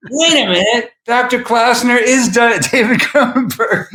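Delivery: high, surprised, laughing; astonished aside; sudden realization. Retold or imitated. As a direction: catch on mid-sentence with a laugh. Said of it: "And then I realized".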